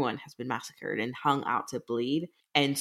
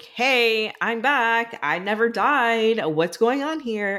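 cheerful announcement; 7 semitones higher, 5 dB louder, slower than usual. Retold or imitated. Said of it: "Melina's like".

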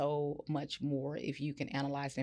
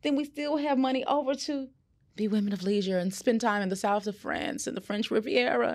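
posh lady of leisure; 8 semitones higher, 5 dB louder than usual. Pretend. Dreaming of a life of leisure.

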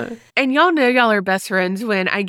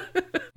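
soft laugh; 8 semitones higher, 13 dB quieter than usual. Real, not acted. Laughs at tease.

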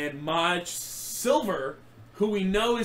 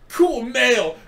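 slower, higher, louder hammed-up macho; mock tough-guy voice; 6 semitones higher, 7 dB louder, much slower than usual. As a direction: laugh through a parody of an edgy caption. Mocking edgy meme descriptors.

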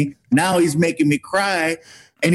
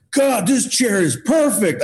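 Big Show quote; 5 semitones higher, slower than usual. Retold or imitated. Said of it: "He's like".

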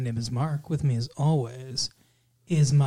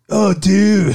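loud, higher California surfer dude; surfer dude accent; 4 semitones higher, 8 dB louder than usual. Acted. Host imitating a guest's role, loud surfer dude accent. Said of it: "Surfer dude accent".

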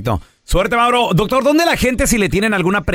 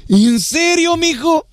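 imitating a mom stunned by her son's grade; amazed mother; 7 semitones higher, slower than usual. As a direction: gasp it like a surprised mother. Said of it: "llegó con su mamá".

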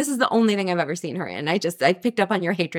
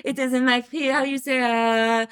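put-on higher, hesitant French accent; 4 semitones higher than usual. Wife citing her prenuptial agreement, hammed-up French accent. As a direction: grope for English words in a mock French accent. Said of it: "only come in this accent now".

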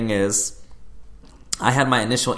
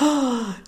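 sharp, audible intake of breath; shocked gasp. Retold or imitated. Crowd reacting to the surprise guest at the book launch, imitated shocked gasp. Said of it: "You could hear the gasp".

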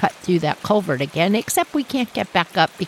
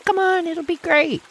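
brother coaxing; 9 semitones higher than usual. Retold or imitated. Brother urging her to jump.

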